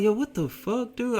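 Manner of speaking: high-pitched baffled protest; confused disbelief. Retold or imitated. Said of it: "I was more so confused".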